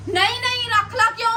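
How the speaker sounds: high-pitched exasperated refusal; indignant protest. Real, not acted. Refusing to keep groceries.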